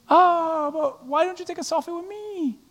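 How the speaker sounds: soft, breathy mock fan voice; hushed imitation of a needy fan; whiny fan. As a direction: play a pouty fan begging. Preacher voicing fans' whiny complaint.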